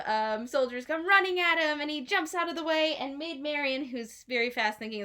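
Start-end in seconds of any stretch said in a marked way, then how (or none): none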